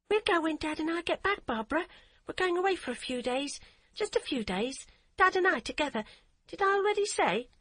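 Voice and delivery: put-on high, bubbly voice of a happy eight-year-old; a child who can't stop repeating her news; excited little girl. A narrator's reading. Child voice of Hadia.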